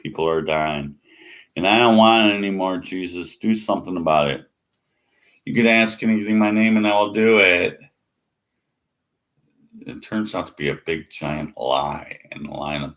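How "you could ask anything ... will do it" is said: fast and louder, hammering the verse; loud mocking quote. Throwing the verse back at believers, a loud mocking quote. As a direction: hammer the promise fast and hard. Quoting the promise loudly and fast.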